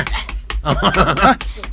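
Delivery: rising giggle; amused giggle at the gossip. Acted. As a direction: let a giggle out. Laughing at a story.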